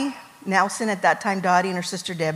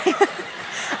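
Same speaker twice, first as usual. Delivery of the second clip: breathy high nervous giggle; 11 semitones higher than usual. Real, not acted. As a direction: giggle from nerves. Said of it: "I'm nervous".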